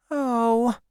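put-on high, disappointed girl's voice; a small crestfallen sigh of a word; sad. A narrator's reading. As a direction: let down, pitched high. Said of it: "said the girl sadly".

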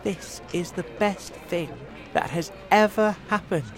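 light, high-pitched contentment; happy thought. A narrator's reading. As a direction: savour the moment inwardly. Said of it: "James thought happily".

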